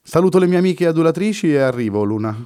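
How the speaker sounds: tossing it off cheerfully; lightly played playboy calling out; breezy exclamation. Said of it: "esclamò".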